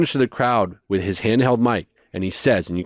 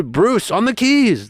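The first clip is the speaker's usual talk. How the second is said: announcer; 11 semitones higher, 3 dB louder, slower than usual. Retold or imitated. Announcer introducing keyboard player.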